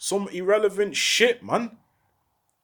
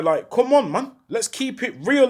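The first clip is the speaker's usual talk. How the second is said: ordinary delivery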